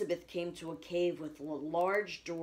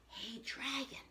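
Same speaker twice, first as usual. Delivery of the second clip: soft, higher whisper; whispering softly; 7 semitones higher, 9 dB quieter, slower than usual. A narrator's reading. Princess checking whether the dragon sleeps, whispering. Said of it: "Elizabeth whispered very softly".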